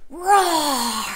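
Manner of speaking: drawn-out playful dinosaur roar. Pretend. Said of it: "roar with me like a dinosaur".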